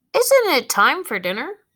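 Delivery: high, loud dog asking for dinner; lightly voiced dog Martha asking her family for dinner. Dog talks for first time.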